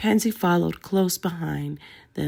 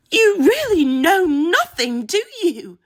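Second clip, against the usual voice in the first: spat in fury; 12 semitones higher, 7 dB louder than usual. A narrator's reading. Grieving Pansy lashes out at Hermione, furious and spitting. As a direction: spit the words at her, enraged. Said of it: "she spat".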